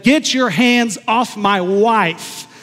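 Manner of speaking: slow, loud, forceful reenactment; retold stern command. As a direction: firmly order him off, slowly and loudly. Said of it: "I eventually said".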